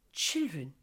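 plain reading voice

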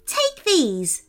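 put-on high, grinning elf girl voice; christmas elf. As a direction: offer it with a big grin. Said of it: "with a huge grin on her face".

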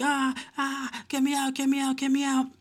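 high, thin mock scream; hammed-up panicked squeal. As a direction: imitate someone trapped and screaming to get out. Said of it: "she's screaming her lungs out".

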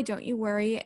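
normal talking voice

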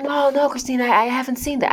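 a hint of a young guy; young coworker voice. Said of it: "he's like".

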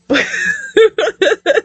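high embarrassed giggle; real flustered laugh. Real, not acted. Laughs off smelling fetish joke.

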